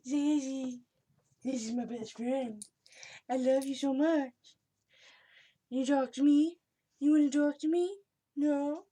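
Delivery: high, loud sing-song pet voice. Real, not acted. Cooing at her cat.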